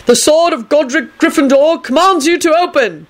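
high, grandiose proclamation; melodramatic proclamation. A narrator's reading. Neville commanding the desk to open, melodramatic. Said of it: "he proclaimed melodramatically".

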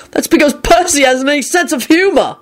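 angry yell. A narrator's reading. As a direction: protest it angrily. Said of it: "Ron yelled angrily".

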